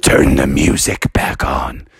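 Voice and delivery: mysterious bird ordering the music back on; deep bird command. Pretend. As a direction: drop the voice way down and command. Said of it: "And the bird said".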